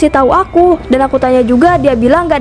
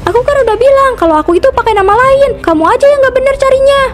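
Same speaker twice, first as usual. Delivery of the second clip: put-on defensive girl, pitched up; Gita's higher voice; 11 semitones higher than usual. Gita snapping back at Ratih, high defensive voice. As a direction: bristle at being called a liar.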